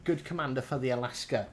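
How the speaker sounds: normal talking voice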